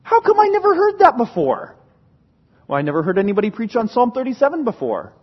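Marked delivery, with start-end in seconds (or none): 0.0-1.8 high, astonished voice of a listener; surprised question. Acted. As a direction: act out the puzzled churchgoer asking this. Quoted listener asking in surprise.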